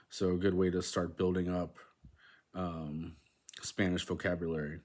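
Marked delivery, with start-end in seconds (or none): none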